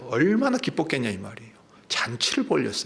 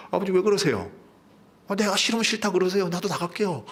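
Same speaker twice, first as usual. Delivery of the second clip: high-pitched, exasperated acted outburst; protesting to father; 7 semitones higher than usual. Elder son challenges his father, exasperated protest. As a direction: threaten to walk out in mock exasperation. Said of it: "아버지한테 항의를 하는 겁니다".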